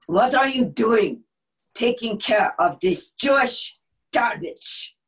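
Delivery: put-on Austrian accent. Nazi officer confronting the woman in the office, loud Austrian accent. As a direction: accuse her sharply in an Austrian accent. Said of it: "accent from Austria".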